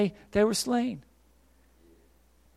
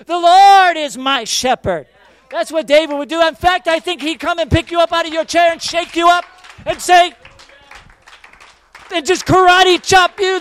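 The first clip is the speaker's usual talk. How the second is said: shouting preacher; 9 semitones higher, 12 dB louder than usual. Real, not acted. Pastor shaking the negative listener awake, fiery shouting. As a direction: shake them awake with it.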